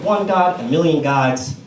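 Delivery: ordinary delivery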